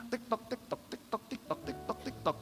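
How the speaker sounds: soft ticking-clock voice; hushed tick-tock. Acted. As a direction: mimic a clock ticking away, fading. Imitating time passing.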